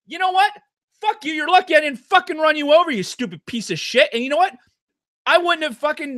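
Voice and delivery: high, loud cursing at the cyclist; imagined shout. Pretend. Driver shouting at the cyclist who cut through, loud and high.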